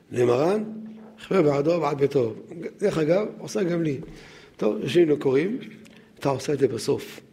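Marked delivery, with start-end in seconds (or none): none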